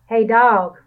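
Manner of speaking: loud call. A narrator's reading. Rat calling over to Dog, loud. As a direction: call across the yard. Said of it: "Rat called".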